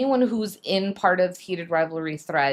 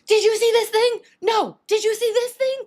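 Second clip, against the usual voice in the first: high, loud frantic squeals; excited screaming; 7 dB louder than usual. Retold or imitated. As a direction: shriek it like a frenzied fan.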